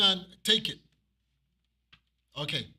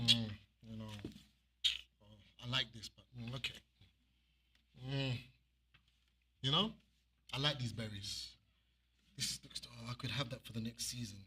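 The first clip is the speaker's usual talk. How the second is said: much softer, low and secretive; sneaky hushed voice; 5 semitones lower, 9 dB quieter than usual. Retold or imitated. Cain sneakily keeping the best berries, hushed. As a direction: keep it to yourself like a hoarder.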